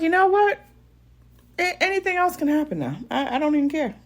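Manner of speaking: high, giddy joy; carefree and thrilled. Retold or imitated. She gushes that the baby is happy she's there, high and giddy. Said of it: "And I was like".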